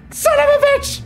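high startled curse; startled scream. Real, not acted. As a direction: shriek at the sudden monster. Monster appears suddenly.

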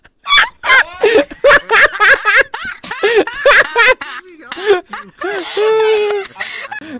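loud burst of laughter. Real, not acted. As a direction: roar with laughter. Class erupts laughing loudly.

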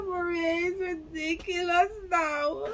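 talking through high, wheezing laughter; real laughing fit; helpless laughter. Real, not acted. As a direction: crack up and barely get it out. Reactor cracks up at the clip.

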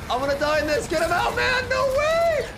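high, frantic calls to teammates; panicked shouting. Real, not acted. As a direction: scream for help, frantic. Teammate stuck, panicked calling out.

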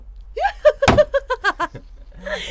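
shrieking burst of laughter; squealing laugh; loud high laugh. Real, not acted. Announcing the winner. (yüksek kahkaha)

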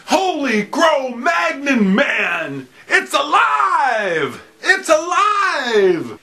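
slow, high, shouting mad scientist. Pretend. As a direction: cry it out like Frankenstein. Frankenstein reveal of guitar.

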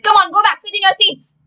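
high, loud scolding command; stern teacher order. Acted. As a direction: send them back to their seats loudly. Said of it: "So she said".

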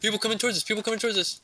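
alarm shout. Real, not acted. Spots enemies approaching.

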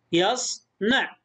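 slow يصنع as "yas ... na'"; split into syllables. Shown as a demonstration. Teacher shows the closed syllables, slowly split. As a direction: pause inside يصنع after the s. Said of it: "مقطع ساكن".

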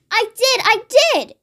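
high, insistent protest; storyteller's mild high-pitched shout. A narrator's reading. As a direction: insist like an indignant child. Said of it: "George shouted".

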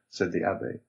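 ordinary delivery

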